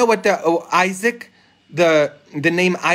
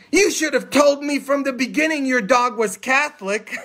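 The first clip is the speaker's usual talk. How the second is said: much higher, hammed-up protest; mock reproachful priest; 9 semitones higher than usual. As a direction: exclaim it as a playful punchline reproach. Priest's punchline after donation offer.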